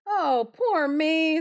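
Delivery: slow, high lament; wailing wagoner. A narrator's reading. A wagoner bemoaning his ruin, slow and high. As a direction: wail it out like a ruined man. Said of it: "the wagoner cried out".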